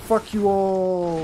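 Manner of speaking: slow drawn-out exasperated curse. Real, not acted. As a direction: drag it out, fed up. Overwhelmed by zombies.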